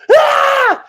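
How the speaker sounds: high piercing wordless scream; sustained high scream; imitated scream. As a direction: shriek like the wife in the background. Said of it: "she's screaming at the top of her lungs".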